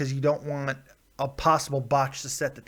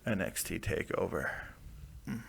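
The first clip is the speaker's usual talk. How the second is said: mock sexy; 6 dB quieter than usual. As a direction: say it soft and smooth, playfully seductive. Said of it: "Oh, I said that sexy".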